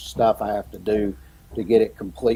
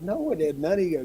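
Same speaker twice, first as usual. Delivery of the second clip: put-on mom soothing child; 8 semitones higher, 4 dB quieter than usual. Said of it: "she goes".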